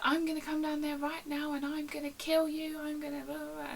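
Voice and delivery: high mock threat; hammed-up ranting threat; aggressive caller. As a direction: imitate a raging customer, trailing into blahs. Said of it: "like, aggressive call I'd had".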